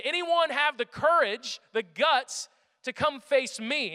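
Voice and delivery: slow, loud, high mocking challenge; Goliath bellowing his challenge at the army; taunting giant. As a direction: call out the whole army, sneering.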